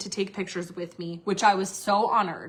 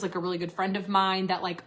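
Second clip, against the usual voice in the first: normal talking voice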